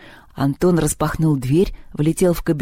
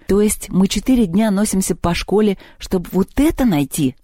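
usual speaking voice

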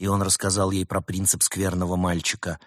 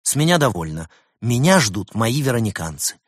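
louder, higher, indignant outburst; angry; 4 semitones higher, 6 dB louder than usual. A narrator's reading. Veronica storms off from Ulysses, angry. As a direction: declare you've had enough, crossly. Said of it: "рассердилась Вероника и резко встала".

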